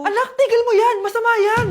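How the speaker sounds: high, urgent warning; alarmed scolding. Acted. Mother warning daughter not to sweep at night, alarmed. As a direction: stop her child at once.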